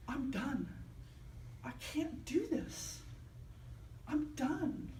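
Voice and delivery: quiet, worn-out inner voice; drained despair. Retold or imitated. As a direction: say it like you're about to give up, softly. Exhausted inner thought in crisis.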